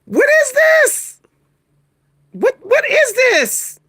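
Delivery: high, slow, baffled wail; voice going real high. Real, not acted. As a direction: stretch it out high in disbelief. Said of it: "My voice go real high".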